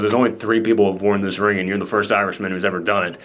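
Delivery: hint of Pesci, hushed and solemn; low, solemn voicing. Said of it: "Pesci gives him a ring and says".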